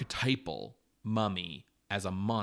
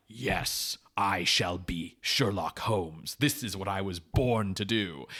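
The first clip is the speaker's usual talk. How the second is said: grand, deliberate actor's proclamation; Peter Cushing; 3 semitones lower, slower than usual. Retold or imitated. Podcaster imitating an actor thrilled by his part, grand declaiming. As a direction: declaim it slowly and emphatically, relishing the role. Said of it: "Peter Cushing was like".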